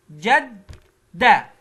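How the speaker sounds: very slow, loud جدة stretched out; drawn-out syllables. Shown as a demonstration. Teacher stretching جدة for kids to repeat. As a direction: stretch جدة into جَدْ then دَة. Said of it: "كيف أقطعها تقطيع صوتي".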